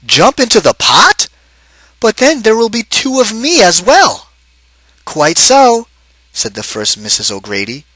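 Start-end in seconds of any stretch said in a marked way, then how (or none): 0.0-4.3 high, startled protest; alarmed husband, voiced up; surprised. 5.0-5.9 high, matter-of-fact wife; crisp little agreement, voiced high. Wife replies in story.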